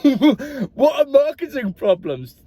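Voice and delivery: high, slow, laughing question; incredulous giggle at the absurd phrase; laughing disbelief. Real, not acted. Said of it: "I just found that hilarious".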